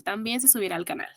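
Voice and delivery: quick soft echo to the chat; quiet quick aside. Shown as a demonstration.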